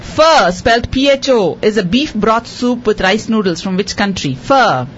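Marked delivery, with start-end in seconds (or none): none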